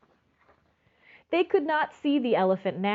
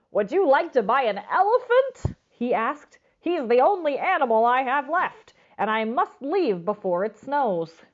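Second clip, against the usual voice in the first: pet man; 5 semitones higher, 5 dB louder than usual. Acted. Pet man voiced in story.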